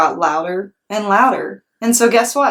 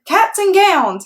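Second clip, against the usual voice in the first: high, slow class holler; whole class hollers back; 12 semitones higher, 3 dB louder, slower than usual. Acted. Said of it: "hollered room nine".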